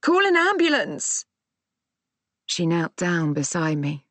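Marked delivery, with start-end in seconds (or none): 0.0-1.2 high urgent call; shouted. A narrator's reading. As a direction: shout the plea much higher. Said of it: "I shouted behind me".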